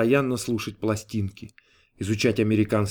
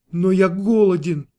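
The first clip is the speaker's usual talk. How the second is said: high, plaintive protest; mildly whiny outcry; whining exclamation; 6 semitones higher, 4 dB louder than usual. A narrator's reading. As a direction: complain like a tearful, hungry customer. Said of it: "воскликнул клиент плаксивым голосом".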